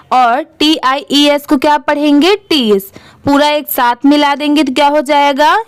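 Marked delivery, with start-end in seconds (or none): none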